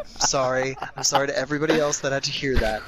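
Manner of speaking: ordinary delivery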